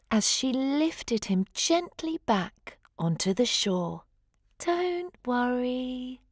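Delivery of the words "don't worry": slow, softer reassurance; a lightly sung comfort; soothing. A narrator's reading. Sea unicorn reassuring the pup, sung softly. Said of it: "she sung".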